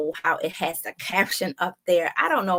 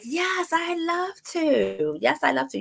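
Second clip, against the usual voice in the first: eager acceptance; 10 semitones higher than usual. Retold or imitated. Guests eagerly accepting invitation.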